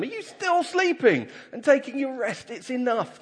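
high-pitched reproachful question; exasperated disbelief. Acted. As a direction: scold the sleepers, pitch leaping right up. Jesus finds them sleeping a third time.

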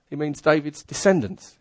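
normal talking voice

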